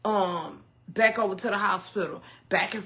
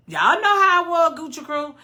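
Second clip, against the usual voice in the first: high, much louder exclamation; angry outburst; 8 semitones higher, 9 dB louder than usual. Retold or imitated. Streamer recalling how angry they were, loud outburst. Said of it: "I was so angry".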